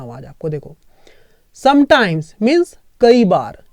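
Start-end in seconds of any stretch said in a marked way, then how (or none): 1.6-3.6 sometimes means कई बार, drawn out slowly; slow for memorizing. Shown as a demonstration. Teacher slowly giving students a word to memorize. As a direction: say it slowly so they can memorize it. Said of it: "इसको याद रख लो".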